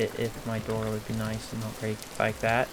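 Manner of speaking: ordinary delivery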